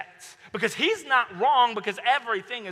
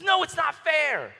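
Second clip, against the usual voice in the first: slow, loud, high whine of injustice; petulant protest; 9 semitones higher, 6 dB louder than usual. Retold or imitated. Cain refusing to take responsibility for his wrong, loud petulant protest. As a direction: draw out the complaint like a sulking kid. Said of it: "he doesn't wanna take responsibility".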